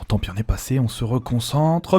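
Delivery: normal talking voice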